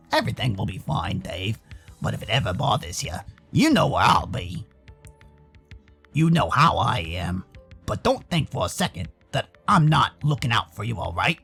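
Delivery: very low, reassuring character voice; low-pitched, steady promise to look out for him; deep-voiced protective friend.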